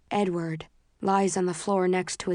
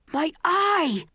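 high, loud pained scream; 10 semitones higher, 4 dB louder than usual. A narrator's reading. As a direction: scream it in agony. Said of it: "he screams".

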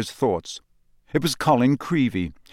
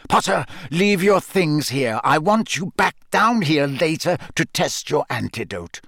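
higher, irritated teacher's order; snapped; 5 semitones higher than usual. A narrator's reading. Teacher dismissing Potter from class, snappish. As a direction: order him off impatiently. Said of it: "Snape snapped".

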